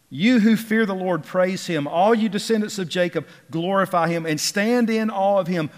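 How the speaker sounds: loud, high pulpit declamation; ringing psalm of praise; exultant scripture reading. Acted. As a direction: proclaim the praise to the whole congregation. Reads praise verses at full force.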